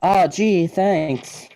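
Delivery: put-on high, eager gee-whiz kid voice; wholesome 1950s kid. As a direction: gush like a wide-eyed kid. Player replies to gym leader.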